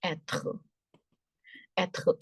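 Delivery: être as "et-khuh", with a throaty r; French pronunciation of être. Shown as a demonstration. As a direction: say être with a soft t and guttural r. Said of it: "the sound of R is h".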